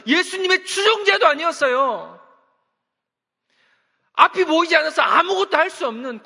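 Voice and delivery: loud, high-pitched sermon delivery; fervent preaching. Acted. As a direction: preach it with rising fervor. Preacher building to full force.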